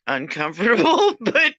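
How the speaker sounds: laughing through the word. Real, not acted. Owner laughing about her dog's licks, giggling. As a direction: break into a laugh.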